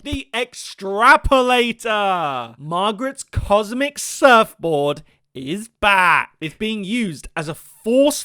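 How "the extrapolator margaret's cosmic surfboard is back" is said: loud, high, slow announcement; hammed-up fanfare. Fan hailing the return of a cosmic surfboard, hammed-up excitement. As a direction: herald it slowly at full voice. Hails a favourite gadget's return.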